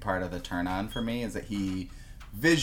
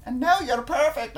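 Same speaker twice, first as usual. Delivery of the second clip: put-on much higher Scottish accent; Scottish accent pushed much higher, sweetly fussing; 3 dB louder than usual. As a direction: pitch the Scottish boyfriend up high. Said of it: "an octave higher".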